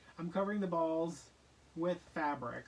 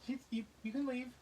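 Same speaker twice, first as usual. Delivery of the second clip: dismissive; 9 semitones higher than usual. Retold or imitated. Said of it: "I was like".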